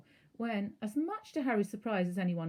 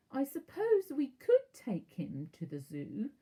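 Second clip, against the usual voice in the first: slow, higher, thinking aloud; slowly; 7 semitones higher, 4 dB louder, slower than usual. A narrator's reading. Aunt musing about the zoo, slow and higher. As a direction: drag it out as the idea forms. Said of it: "said Aunt Petunia slowly".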